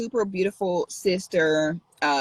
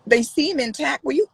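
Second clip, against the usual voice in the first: usual speaking voice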